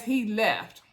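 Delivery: normal talking voice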